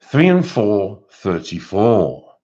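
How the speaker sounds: slow drawn-out bingo call; bingo caller. Retold or imitated. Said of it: "As the bingo caller would say".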